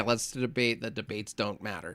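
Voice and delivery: quiet mocking aside. Real, not acted. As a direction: toss it off quietly, half laughing. Laughing mockery.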